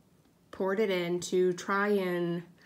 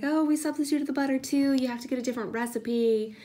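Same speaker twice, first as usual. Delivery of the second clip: higher, louder friend's voice; imitating friend; 5 semitones higher, 5 dB louder, faster than usual. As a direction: play the chirpy friend texting back. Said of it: "she's like".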